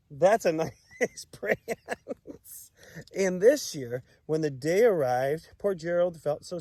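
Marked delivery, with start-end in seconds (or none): 0.1-2.7 laughing. Real, not acted. Laughing at own prance.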